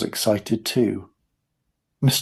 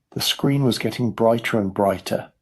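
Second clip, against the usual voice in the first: ordinary delivery, nothing marked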